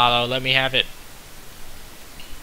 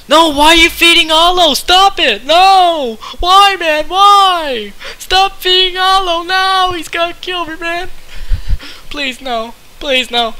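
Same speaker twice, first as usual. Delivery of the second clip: much higher, loud shrill-voiced pleading; hammed-up high cartoon panic; squeaky mock panic; 6 dB louder than usual. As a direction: squeal at them to stop in a tiny voice. Panicking as rival is fed.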